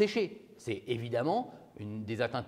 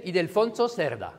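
put-on Spanish accent, higher pitch; 4 semitones higher than usual. Shown as a demonstration. Lecturer pretending to know Spanish for the class, Spanish accent. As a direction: say the name with a showy Spanish accent. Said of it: "Je vous fais genre, je connais l'accent espagnol".

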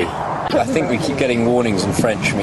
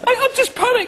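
far higher, louder squeaky voice; starstruck squeak; 4 dB louder than usual. Retold or imitated. Co-star mimicking Jason greeting Sir Alex, in a much higher squeak. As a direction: squeak it like a panicked starstruck fan. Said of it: "And he goes".

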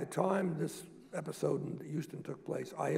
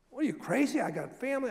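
alarmed protest; 10 semitones higher, 6 dB louder than usual. Retold or imitated. Bernie alarmed at the idea.

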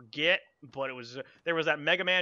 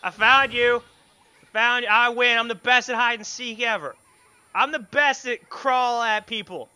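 loud, higher gloating; 3 semitones higher, 11 dB louder, slower than usual. Real, not acted. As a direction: crow over winning hide and seek. Gloating at the found ghost.

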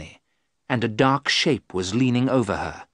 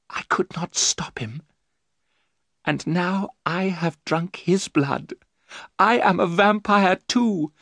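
high, trembling Mina; frightened young wife; 7 semitones higher than usual. Acted. Mina telling the men of her terror, high-voiced. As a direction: recount the nightmare, much higher.

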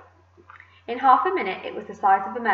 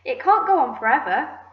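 raised, louder exclamation; yelling; 5 semitones higher, 5 dB louder than usual. A narrator's reading. Aunt protesting at the growing peach, yelling. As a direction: yell it in disbelief. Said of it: "Aunt Spiker yelled".

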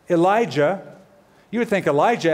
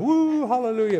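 mock charismatic whoop; 8 semitones higher than usual. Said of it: "getting all charismatic and crazy".

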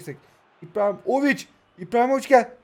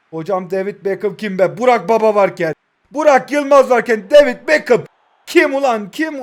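mock outrage; 2 semitones higher, 9 dB louder than usual. Real, not acted. Outraged at Beckham's presence.

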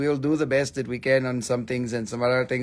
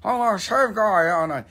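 lightly voiced smug clean-shaven guy; a hint of preening at the mirror; playful put-on voice of his shaved past self; mock shaved self; 5 semitones higher than usual. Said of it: "and it was like".

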